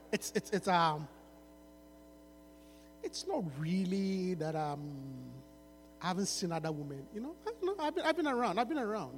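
low, soft, mock seductive lines; smooth-talking rapper.

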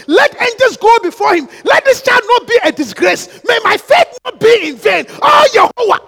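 desperate shouted pleading to God; screamed prayer. Shown as a demonstration. Preacher demonstrating prayer for a child in danger, shouted. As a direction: cry out each plea at full force. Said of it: "you have to scream".

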